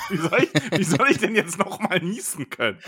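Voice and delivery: laughing while he talks; giggling through the words. Real, not acted. Asked to sneeze again, laughing.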